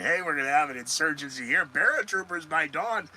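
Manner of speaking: quick, rising mock forecast patter; weatherman. Host mimicking a weatherman giving a war forecast, hammed-up. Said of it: "like the weathermen do it".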